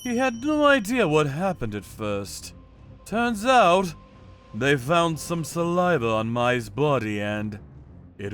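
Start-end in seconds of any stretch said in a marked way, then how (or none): none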